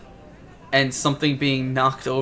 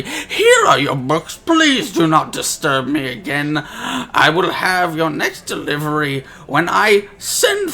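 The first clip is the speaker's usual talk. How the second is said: loud, raised, irritated NPC voice; annoyed shopkeeper; 3 semitones higher, 5 dB louder than usual. Acted. GM voicing an annoyed shopkeeper at his door, loud and irritated. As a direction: brush off the visitors impatiently. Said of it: "very annoyed voice inside".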